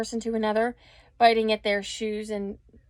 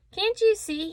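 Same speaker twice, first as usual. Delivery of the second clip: high, pitched-up shout; 10 semitones higher than usual. A narrator's reading. Rat shouting at the crowd, high and indignant. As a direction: yell it at the crowd. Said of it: "he, I, he shouted".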